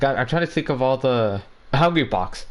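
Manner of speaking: ordinary talk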